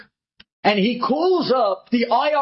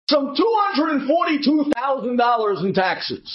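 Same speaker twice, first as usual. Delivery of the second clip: high, stern, swelling to a shout; mock bureaucrat building to a yell; official demand; 7 semitones higher than usual. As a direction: read the tax demand like an accusation. Tax office letter read out.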